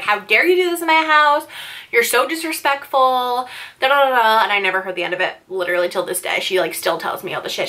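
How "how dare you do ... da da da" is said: mock furious mom, higher; hammed-up livid mother scolding; imitating an outraged mom telling her daughter off; angry mom. Livid mom voiced in story.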